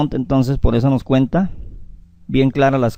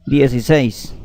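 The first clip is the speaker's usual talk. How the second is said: ordinary talk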